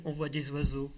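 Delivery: ordinary delivery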